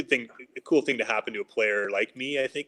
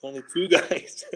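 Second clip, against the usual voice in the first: mock yell; 6 semitones higher than usual. Coach telling off a rookie behind the door, mock yell. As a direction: imitate a coach's yell with a held-back voice. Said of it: "he's trying to yell at me".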